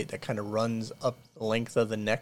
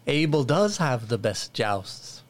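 peasant villager. Acted. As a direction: welcome the lords like a fawning villager. GM voices peasant Abel.

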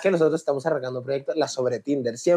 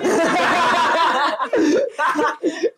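high-pitched laugh bursting out; high burst of laughter; 14 semitones higher than usual. Real, not acted. Host cracking up at the Poncho joke, laughing high.

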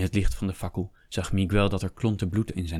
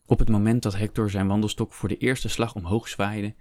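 plain reading voice

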